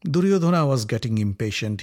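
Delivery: usual speaking voice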